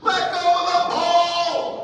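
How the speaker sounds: full-volume, high drawn-out holler; yelling. Retold or imitated. Dad to his son tied up with bigger kid, urgent yell. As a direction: yell it across a gym, stretching the words. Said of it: "I'm yelling at Bentley".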